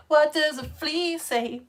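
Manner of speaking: silly flea riddle voice. Shown as a demonstration. Reading Jacob's comment as supposed to be said, silly drawn-out voice. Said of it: "supposed to be said like".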